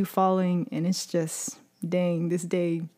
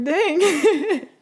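laughing dang; 8 semitones higher, 3 dB louder than usual. Retold or imitated. Laughing at mom smiling during fight.

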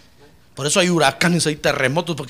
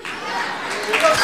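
high breathy laugh; 7 dB quieter than usual. Real, not acted. Preacher laughs at his joke.

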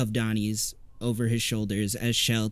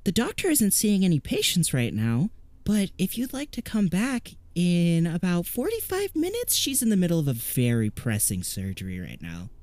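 higher, a lightly voiced child; a hint of a child's pretend-serious voice; 7 semitones higher than usual. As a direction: play a little girl playing doctor. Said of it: "the voice of a child".

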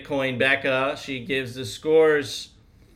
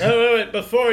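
loud, higher no wait wait; urgent interruption; 8 semitones higher, 8 dB louder, much faster than usual. Real, not acted. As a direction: jump in to stop them. Cutting in before rating.

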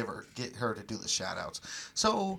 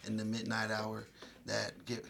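prayer; 2 semitones lower, 3 dB quieter than usual. Acted. Prays over listeners.

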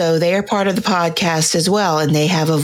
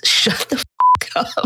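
higher, slow, mock-annoyed telling-off; mock irritation; 6 semitones higher than usual. Woman to her sneezing man in a meme, mock annoyed. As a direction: drag it out in put-on exasperation.

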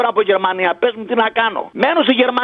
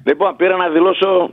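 ordinary talk

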